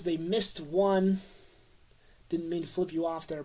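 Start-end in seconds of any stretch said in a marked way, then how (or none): 0.0-1.2 slowed right down for emphasis. Real, not acted. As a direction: lay each word down slowly. Stressing the lost Super Bowl.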